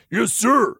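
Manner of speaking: ordinary delivery, nothing marked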